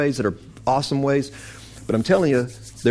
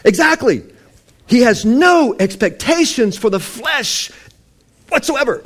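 preaching full force; 9 semitones higher, 4 dB louder than usual. Real, not acted. Preacher answering the congregation, loud and emphatic. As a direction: hammer it home like a fired-up preacher.

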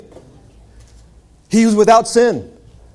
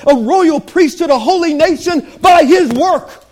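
preaching that builds louder and higher; rising preaching; 5 semitones higher, 3 dB louder than usual. Acted. Preacher rallying the congregation, building to a shout. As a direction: climb toward a full shout of praise.

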